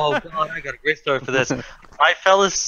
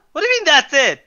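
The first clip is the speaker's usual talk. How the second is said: indignant; 8 semitones higher than usual. Real, not acted. Fan defending Spider-Man to a doubting co-host, indignant. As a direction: protest as if it should be obvious.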